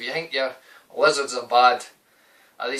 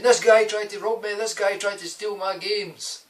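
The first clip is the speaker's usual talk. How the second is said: high, loud plea of a wronged victim; acted outcry; 9 semitones higher, 4 dB louder than usual. Accusing the junky-looking guy to the shop woman, acted outcry. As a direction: cry it out as a hammed-up accusation. Retold false outcry to a witness.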